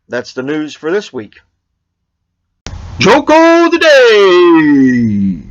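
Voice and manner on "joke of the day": slow, louder, higher announcer voice; announcer title call. Acted. Vlogger announcing the joke segment in a drawn-out announcer voice.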